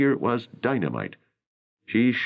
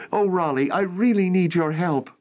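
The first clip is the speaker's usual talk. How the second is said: raised, lightly voiced young actress; a worried young actress pleading, narrator lifts his pitch higher; April's voice; 11 semitones higher than usual. A narrator's reading. Narrator voicing April to Raleigh, worried and higher.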